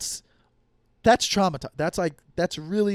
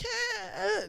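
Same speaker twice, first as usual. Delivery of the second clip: imitating quirky girl; 5 dB quieter than usual. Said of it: "she's like".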